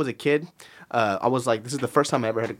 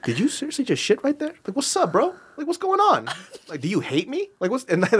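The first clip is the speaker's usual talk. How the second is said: high, mock-exasperated scolding of a dog; 10 semitones higher than usual. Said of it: "talking to her sometimes when she makes me mad".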